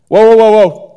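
loud, urgent whoa; calling out. Retold or imitated. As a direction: shout him down before he runs. Calling out to healed man.

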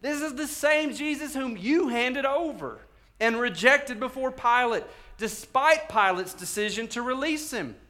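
high, loud, drawn-out accusation; Peter getting after them. Acted. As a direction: confront the crowd as a fiery apostle. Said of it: "Peter gets after it".

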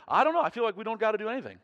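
high, loud, quick; excited uncertainty. Retold or imitated. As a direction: blurt it out with a shrug. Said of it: "I said".

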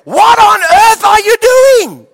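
high, drawn-out full-voiced yell; imitated scolding bellow, very high and loud; mother's shout. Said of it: "used to shout it".

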